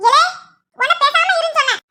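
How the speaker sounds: high, irritated order to hush; scolding. Acted. Grandmother silences the husband.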